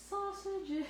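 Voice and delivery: high-pitched, playful sing-out; silly voice in your head. Pretend. Host teasing viewers who hear her voice, silly high voice. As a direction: pipe it up high like a silly earworm. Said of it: "my voice in your head".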